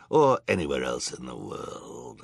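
low, growling villain; villain's growl. A narrator's reading. Villain Olaf dismissing justice to the judge, low growl. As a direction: growl it darkly, pitch dropped. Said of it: "Olaf growled".

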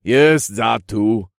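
slow, loud regal drawl; dismissive king. Acted. King waving away the guess, dismissive slow voice. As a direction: brush it aside in a stretched royal voice. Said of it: "The king waved a dismissive hand".

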